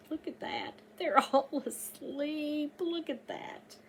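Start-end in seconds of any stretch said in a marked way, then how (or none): none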